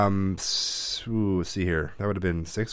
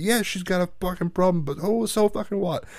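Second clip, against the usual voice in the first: high-pitched retold scolding; acted-out telling-off, not shouted; dad laying into son; 10 semitones higher than usual. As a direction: imitate dad's indignant lecture. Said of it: "just laid into me about it".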